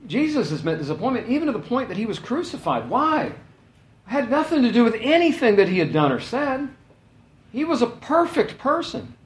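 high, fast preaching climb; impassioned preaching. Acted. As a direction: preach it with rising passion. Preaching at full force.

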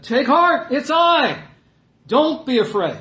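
high, loud reassurance; calling out. Acted. Preacher as Jesus calling to frightened disciples, loud call.

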